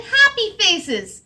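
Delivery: slow, high delighted hen voice; clucking hen. Acted. Hen admires the library's happy faces, slow and high. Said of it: "clucked the hen".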